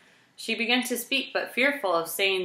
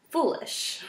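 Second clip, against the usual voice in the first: usual speaking voice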